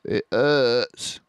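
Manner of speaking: a hurt little wince; lightly voiced hurt fox; Mister Fox in pain. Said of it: "said Mister Fox".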